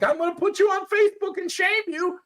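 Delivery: high, shouted mock of a bystander filming; mocking phone filmer. Mocking a bystander who films a fight, high shouted squeal.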